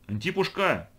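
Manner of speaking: put-on high-pitched boy's call. A narrator's reading. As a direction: call a man across the workshop. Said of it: "крикнул Тимоша". (звонко окликнуть)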